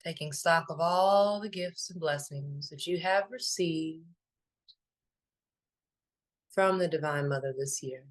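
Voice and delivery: calm, unhurried meditation voice with long pauses; even, spacious delivery, letting each phrase settle; guided meditation. Acted. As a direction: lead listeners gently inward, phrase by phrase.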